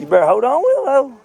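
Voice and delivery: calling out. Real, not acted. Father calling to child on slide.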